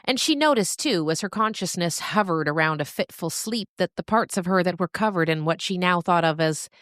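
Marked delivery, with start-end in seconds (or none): none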